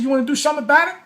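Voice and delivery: loud, high-pitched retold dare; bluffing challenge. Storyteller dares the six-foot cameraman, bluffing challenge. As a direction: push the dare upward, spoiling for it. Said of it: "I'm calling his bluff".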